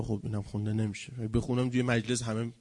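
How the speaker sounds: ordinary talk, nothing marked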